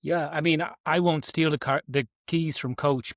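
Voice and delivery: ordinary delivery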